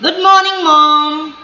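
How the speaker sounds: put-on child greeting mom. Shown as a demonstration. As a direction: call good morning to mom cheerfully. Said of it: "See my tone, my stress". (माँ को खुश होकर अभिवादन)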